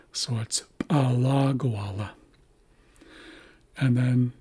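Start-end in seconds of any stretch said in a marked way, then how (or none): 0.9-2.1 Alagwala as pa-LA-gwa-la, LA held long; second-syllable stress. Shown as a demonstration. Teacher demonstrates the shifted accent, stress on second syllable. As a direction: pop the P, then stress LA in "Alagwala". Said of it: "shift the accent to the second syllable".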